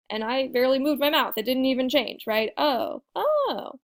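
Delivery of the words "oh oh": ordinary talk, nothing marked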